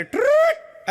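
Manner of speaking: sharp high mock whistle; shrill imitated whistle blast; whistle imitation. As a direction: whistle like a watchman calling out. Watchman whistles at old man.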